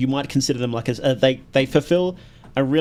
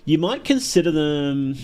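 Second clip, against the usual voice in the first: usual speaking voice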